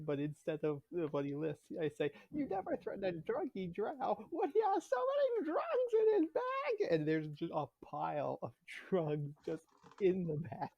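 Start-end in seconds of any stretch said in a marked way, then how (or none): 2.3-6.9 gloating rant climbing to a high cry; Vizzini-style gloat. Acted. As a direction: build from smug to a high shriek. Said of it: "It's that speech exactly".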